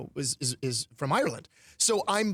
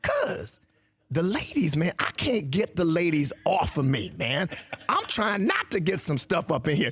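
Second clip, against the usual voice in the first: hammed-up Southern ladies' man; playful Southern drawl; Southern cousin accent; slower than usual. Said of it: "impersonating my cousins from the South".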